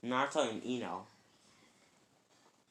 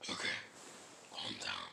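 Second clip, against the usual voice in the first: very low, voiceless beast rasp; whispered monster voice; Kurama; 8 dB quieter than usual. Acted. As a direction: growl it softly under the breath. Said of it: "my Kurama voice isn't as good".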